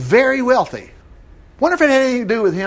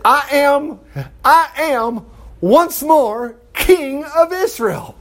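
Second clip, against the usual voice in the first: high, drawn-out proclamation; exultant royal announcement; triumphant declaration; 7 semitones higher, much slower than usual. Acted. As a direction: proclaim it slowly, pitched up. David's triumphant declaration, acted.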